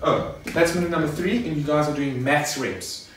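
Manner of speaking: normal talking voice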